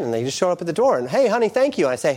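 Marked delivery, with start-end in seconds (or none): 1.1-1.9 higher, chirpy thanks; a bright little thank-you, pitched up; pleased wife. Acted. Wife thanking husband for the monthly flowers, high and chirpy.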